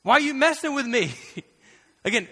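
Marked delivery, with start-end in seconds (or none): 0.0-1.1 high, loud calling voice; Jesus calling out. Retold or imitated. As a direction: call out like a friend confronting. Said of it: "Jesus showed up and was like".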